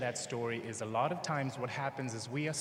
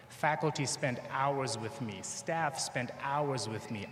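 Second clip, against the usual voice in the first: slow emphasis; slower than usual. Real, not acted. Emphatic parallel lines, slowed.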